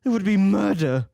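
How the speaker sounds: high, loud anguished plea; desperate outcry. A narrator's reading. Dorian pleading to save the portrait, desperate. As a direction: stop him at all costs.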